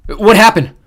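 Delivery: loud, high yell into the comms. A narrator's reading. Xander yelling to Johnny over the comms, loud. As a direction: call him out at full voice. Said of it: "Xander yelled".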